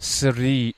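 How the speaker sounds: slow, careful try at "Siri"; tentative French surname attempt. Real, not acted. Host guessing French chef's last name, tentative attempt. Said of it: "I don't know how to say his last name".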